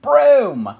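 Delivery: loud, firm call to the broom; commanding shout. A narrator's reading. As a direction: order it, loud and sure. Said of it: "May shouted".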